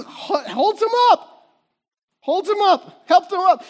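high, loud, rising excitement; excited lifting. Acted. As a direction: cry it out as he lifts him. Preacher acts out lifting the man.

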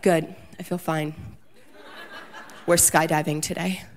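flat, dead-serious answer, lightly played; stone cold serious. Husband answering the video guy, deadpan serious. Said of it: "[name] is stone cold serious".

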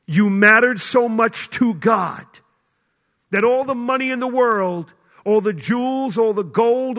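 fiery sermon climax; full-force preaching. Acted. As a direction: pound each all like a pulpit preacher.

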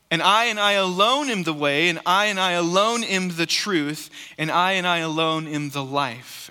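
building then settling repetition, alone stressed each time; proclaiming alone, slowing toward the life. Acted. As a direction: lean hard on alone in each line. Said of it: "He's saying".

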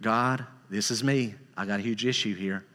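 a hint of a humble prayer; quiet confession. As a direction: murmur it to God, humbled.

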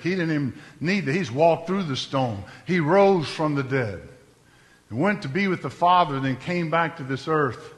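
usual speaking voice

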